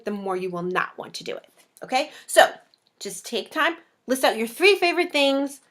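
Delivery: ordinary talk, nothing marked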